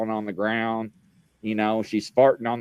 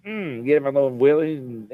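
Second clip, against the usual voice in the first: usual speaking voice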